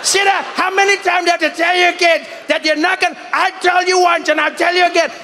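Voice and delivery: hammed-up fed-up father shouting; exasperated dad. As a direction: yell at bickering kids to shut up. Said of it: "like a dad who had kids that were fighting".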